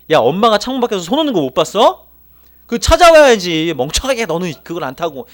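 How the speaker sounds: loud, high-pitched acted telling-off; mock motherly scolding. Mother scolding a child who missed the bus, acted angry scolding. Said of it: "저희 어머니처럼 혼내진 않으십니다".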